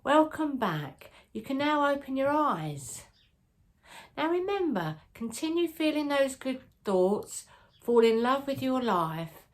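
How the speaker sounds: high, lilting welcome back from meditation; meditation wake-up. Acted. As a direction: gently lift listeners out of the visualization. Brings listeners out of the visualization.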